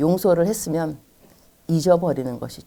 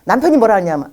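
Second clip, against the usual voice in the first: ordinary talk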